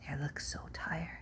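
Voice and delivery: a hushed bedtime whisper; sleepy whisper. A narrator's reading. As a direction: whisper so they won't wake. Monkeys falling asleep.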